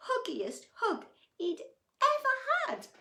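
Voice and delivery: high, loud, gleeful climax; excited reading. A narrator's reading. Reading the big group hug to children, excited. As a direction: make the hug sound enormous.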